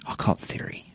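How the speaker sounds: whispered aside. Real, not acted. A whispered aside to the audience, half joking. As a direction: drop to a breath, no voice.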